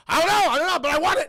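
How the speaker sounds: loud, high, bursting with glee; acted-out giddy dad; overjoyed dad. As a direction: shout it like a thrilled winner. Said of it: "He is so happy".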